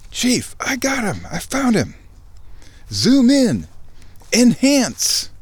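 a thrilled tech calling the chief over, barking zoom and enhance; excited analyst. Retold or imitated. Excited analyst finds the target.

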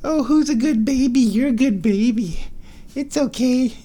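high, cooing praise. Real, not acted. Owner praising her cat in high baby talk.

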